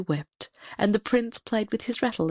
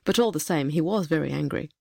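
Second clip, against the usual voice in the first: ordinary delivery, nothing marked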